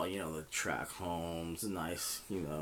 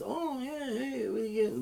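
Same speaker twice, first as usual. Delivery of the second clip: much higher, put-on voice; mock chatting up kids. Old man approaching kids at the soda fountain, mock friendly. Man's overture to kids, acted out.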